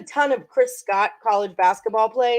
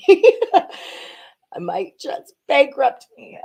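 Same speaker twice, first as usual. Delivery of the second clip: laughing; 8 semitones higher, 5 dB louder, much slower than usual. Real, not acted. Laughing at own betting losses.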